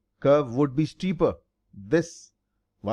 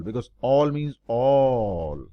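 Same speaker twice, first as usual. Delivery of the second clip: each "all" said slow and heavy; stress on all; 3 semitones lower, much slower than usual. Shown as a demonstration. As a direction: hammer home that "all" means every bit. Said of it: "I emphasize on the word all".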